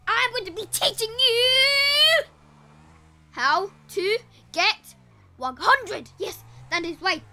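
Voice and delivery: big, slow, loud reveal; dramatic slow announcer hype; hyped announcement. Acted. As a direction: announce it like a trailer. Dramatic hundred-sub announcement.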